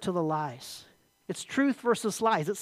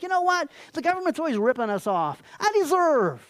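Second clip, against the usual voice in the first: high-pitched entitled protest; indignant self-justification; 6 semitones higher, 4 dB louder than usual. Acted. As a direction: argue yourself into it, indignantly. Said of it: "And you're like".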